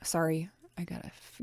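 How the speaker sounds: ordinary delivery, nothing marked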